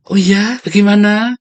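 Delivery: high, loud syrupy coo; sweet flirt. Retold or imitated. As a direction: lure them in with honeyed interest. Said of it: "lama-lama kalau ngomong bisik-bisik".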